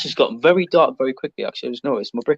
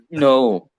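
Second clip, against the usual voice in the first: ordinary delivery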